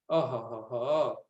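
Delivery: slow low mock laugh; a slow, low teasing ho-ho-ho; teasing chuckle. Guru chuckling at the student, slow low teasing laugh. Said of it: "సరసం ఆడారట".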